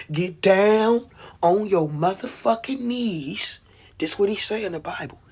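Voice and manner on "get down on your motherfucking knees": lightly acted commanding God, drawn out; slow, raised mock-divine order.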